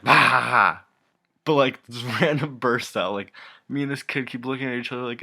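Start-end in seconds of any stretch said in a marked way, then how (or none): 0.0-0.8 loud hammed-up guffaw; mock loud laugh. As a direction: bark out his sudden laugh. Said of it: "'cause he's like".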